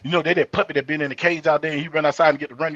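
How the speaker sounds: ordinary talk, nothing marked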